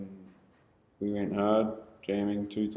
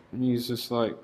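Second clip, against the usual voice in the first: ordinary talk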